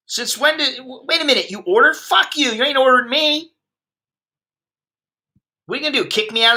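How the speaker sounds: high-pitched indignant comeback; defiant outburst. Real, not acted. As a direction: throw the order right back at them.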